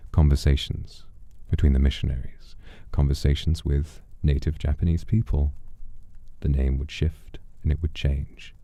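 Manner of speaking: usual speaking voice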